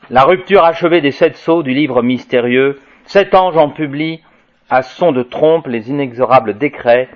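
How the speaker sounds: measured, ringing proclamation of prophecy; solemn scripture reading. Acted. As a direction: proclaim the apocalyptic text to the room. Reads Apocalypse aloud to audience.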